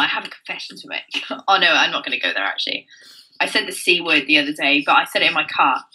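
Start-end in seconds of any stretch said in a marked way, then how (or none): 0.0-1.2 hushed confession. Real, not acted. Host confiding a naughty secret to her co-host, hushed. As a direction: drop to a near whisper, conspiratorial.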